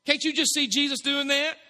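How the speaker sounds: usual speaking voice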